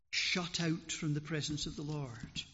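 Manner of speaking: ordinary delivery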